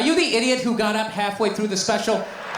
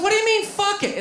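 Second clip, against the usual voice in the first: put-on indignant girlfriend; 9 semitones higher than usual. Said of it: "She goes".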